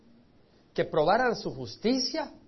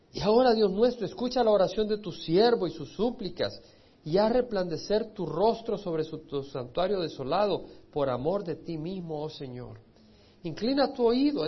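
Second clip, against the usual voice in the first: loud, pleading proclamation of scripture; louder preacher's prayer voice; fervent prayer reading; 4 dB louder than usual. Acted. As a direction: cry the plea out to God from the pulpit. Reads Daniel's prayer aloud.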